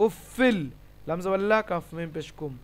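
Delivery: normal talking voice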